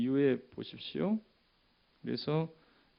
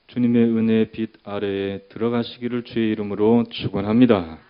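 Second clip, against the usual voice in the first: loud, sustained pulpit benediction; ringing sermon blessing at full voice; preached blessing; 11 dB louder than usual. Real, not acted. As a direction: proclaim the blessing over the whole congregation. Pronounces a blessing on congregation.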